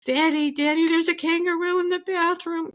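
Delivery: put-on high child's voice calling Daddy; a small boy calling for his dad; little boy screaming. Telling how a boy yelled for his dad, high child's cry. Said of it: "You gotta do it in Aus-, an Aussie tone".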